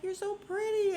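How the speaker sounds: high-pitched imitation of a gushing compliment; mock gushing. Wife greeting her husband's publisher, mock gushing.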